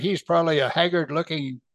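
ordinary talk, nothing marked

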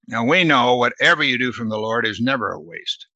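ordinary delivery

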